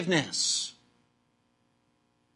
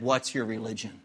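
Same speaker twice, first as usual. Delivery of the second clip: low, softer gruff gunman; 9 semitones lower, 6 dB quieter than usual. Retold or imitated. Gunman behind player in Belfast, low and gruff. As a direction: lightly voice a gruff threat, pitched low. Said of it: "a very gruff voice said".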